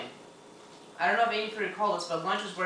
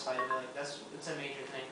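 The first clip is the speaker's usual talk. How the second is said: ordinary talk, nothing marked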